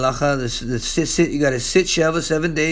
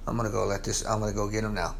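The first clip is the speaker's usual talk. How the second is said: much lower and softer, a weary inner voice; Jesus thinking aloud; 9 semitones lower, 11 dB quieter, faster than usual. Acted. Jesus thinking to himself about the mourners, low and soft. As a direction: mutter it to yourself, resigned. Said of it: "you know he's thinking".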